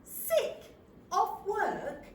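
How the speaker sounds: high, loud echo of disbelief; incredulous query. Acted. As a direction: repeat it back, astonished. Mishears nurse, repeats in disbelief.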